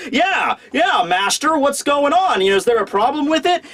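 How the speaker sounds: high, chipper voice for a worker eager to please; hammed-up Frankie as a humble carpenter. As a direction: answer the boss brightly and a bit nervously. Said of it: "he's like".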